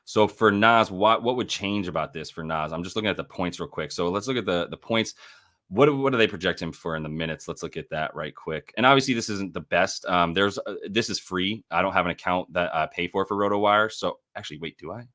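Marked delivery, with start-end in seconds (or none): none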